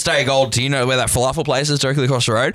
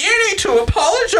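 high, nagging put-on voice; imitating the drunk woman's friend; mock demanding friend. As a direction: demand it like a self-righteous friend. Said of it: "her friend came up to me and was like".

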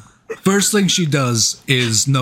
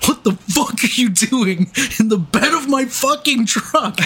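high-pitched, indignant retold tirade; retold rage; 9 semitones higher than usual. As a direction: act out mom flipping into rage. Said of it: "immediately flips into rage".

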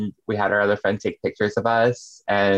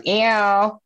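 high cat-like ew; cat meow; 11 semitones higher than usual. Retold or imitated. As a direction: squeal it like a cat. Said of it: "it goes".

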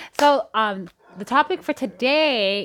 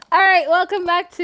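excited intro; 10 semitones higher, 7 dB louder than usual. Acted. Hyped show intro.

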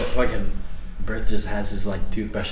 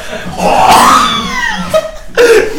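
loud shrieking laugh; 9 dB louder than usual. Real, not acted. Friend spits out blue thing.

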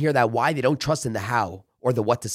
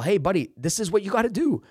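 higher, upbeat coach voice giving orders; coaching a trainee; 7 semitones higher than usual. Retold or imitated. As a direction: hype the buddy up, tell him how it's done. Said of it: "I was telling people".

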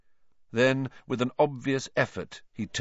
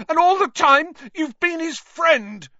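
very high, loud, furious accusation; out-of-control shout; 9 dB louder than usual. A narrator's reading. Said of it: "his voice wavering out of control".

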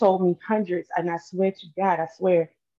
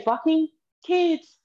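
mocking quote; 8 semitones higher, slower than usual. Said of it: "is what you said".

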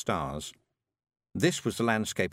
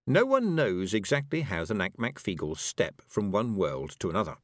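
usual speaking voice

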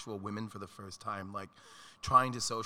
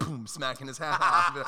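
ordinary delivery

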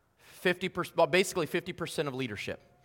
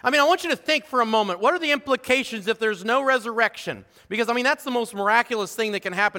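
urgent preaching; 6 semitones higher, 6 dB louder than usual. Acted. Preacher picks up again.

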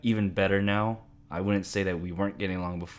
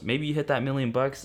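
ordinary talk, nothing marked

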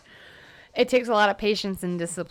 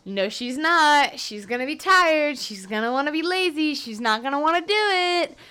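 higher-pitched imitation of doubting listeners; mock doubting listeners; 6 semitones higher than usual. Podcaster imagining listeners doubting she will post tomorrow, mock doubting. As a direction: mimic naysayers in a raised, drawn-up voice. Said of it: "you guys are gonna be like".

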